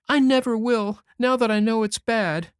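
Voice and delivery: put-on high, loud, tearful boyish promise; between sobs. A narrator's reading. Davy sobbing his promise to Anne and Marilla, tearful. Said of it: "asseverated Davy between sobs".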